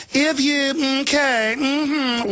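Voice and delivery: a hint of a simpering elite; prissy Nelly. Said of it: "Wise old Nellies that rule us".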